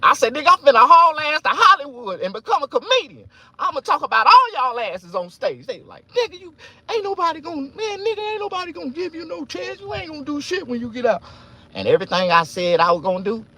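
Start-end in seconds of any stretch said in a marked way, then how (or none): 0.3-5.7 high, loud, boastful answer; loud high defiant boast; defiant shout. Retold or imitated. His defiant answer to guards. 6.1-11.2 very high, fast scornful voice; high scornful guard mockery; mocking guard voice. Guards mocking his dream.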